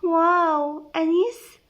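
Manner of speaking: high, flirty cooing; coquettish.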